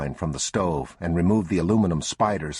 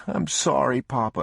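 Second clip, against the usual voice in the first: put-on higher, near-sobbing child's apology; a touch of a kid about to cry; tearful boy; 8 semitones higher than usual. A narrator's reading. Boy apologizing to his father, close to tears. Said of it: "He was beginning to sob".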